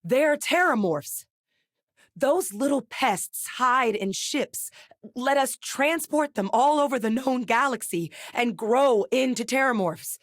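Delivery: high, emphatic excitement of discovery; urgent revelation. Acted. Telling a partner how terramorphs spread between planets, excited. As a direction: announce a startling discovery, pitched high.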